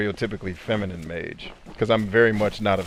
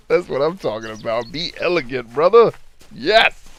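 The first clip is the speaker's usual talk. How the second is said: rising to a high, loud yes; excited cheering; 5 semitones higher, 5 dB louder than usual. Real, not acted. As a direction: hype him up, building to a shout. Cheers on the viewer's answer.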